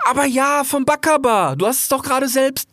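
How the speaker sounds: very high, loud youthful cry of delight; young fox thrilled to see his friend, high and loud; excited young fox. Acted. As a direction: squeal with joy at seeing a friend. Rix insists.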